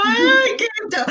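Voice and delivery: high, tearful little gush; touched. Retold or imitated. Mother recalling being moved to tears by her son's greeting. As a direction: melt at your child's words. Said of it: "Y yo como".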